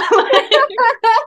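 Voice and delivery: a burst of high, bright giggling; high giggle. Real, not acted. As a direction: laugh it off, embarrassed and delighted. Guest laughs after baring her soul.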